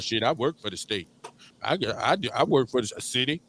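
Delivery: low-pitched mock self-satisfaction; complacent government worker. Worker bragging about a state job, lightly mocked. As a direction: lightly imitate a contented employee, dropping the pitch. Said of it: "to be happy about they hoe and talking about".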